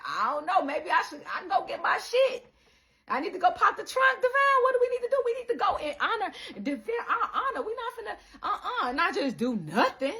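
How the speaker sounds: high, loud, fast and laughing agitation; worked-up self. Retold or imitated. Her retold self wanting to fight, high and laughing. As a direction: get riled up, half laughing. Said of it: "You like".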